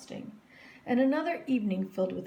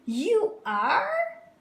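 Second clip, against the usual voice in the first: put-on surprised girl; 13 semitones higher, 6 dB louder than usual. A narrator's reading. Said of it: "said Victoria".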